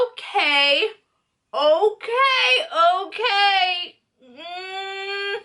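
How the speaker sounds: high, drawn-out mock whine; worked-up disbelief. Woman reliving finding his meetup plans, high worked-up whine. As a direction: squeal the okays as if barely holding it in.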